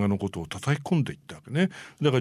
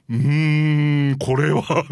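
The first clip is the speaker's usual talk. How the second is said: long drawn-out, high mock hesitation; doubtful groan; 4 semitones higher, 5 dB louder, much slower than usual. Producer balks at a finished model, exaggerated doubt. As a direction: stretch out the hum in hammed-up misgiving.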